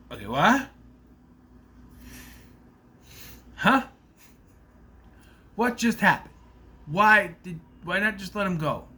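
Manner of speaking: high, loud stunned reaction; shocked disbelief. Real, not acted. Vlogger retelling his reaction to the shooting, high stunned disbelief. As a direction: react as if you can't believe what you saw.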